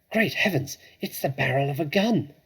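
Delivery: sudden startled alarm; alarmed gasp. A narrator's reading. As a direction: gasp it out in shock. Narrator voices sudden alarm.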